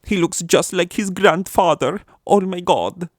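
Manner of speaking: high, loud, drawn-out fake German; bad German accent. German parent marvelling at the baby, in a bad German accent. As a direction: overdo a German accent that drifts Scandinavian. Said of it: "This is really bad".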